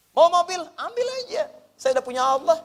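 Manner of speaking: high-pitched, dismissive offering; acted carefree indifference. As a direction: wave it all away as unimportant. Acting carefree surrender of worldly things. (nada tinggi, pura-pura tak peduli)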